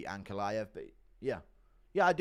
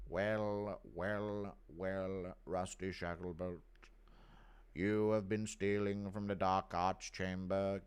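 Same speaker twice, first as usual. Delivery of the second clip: lower, slow, sneering drawl; Snape impression; 4 semitones lower, 5 dB quieter, slower than usual. Catching a student stealing, slow sinister sneer. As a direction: savour every word like a menacing professor. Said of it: "Impression of racist Snape catching Rusty".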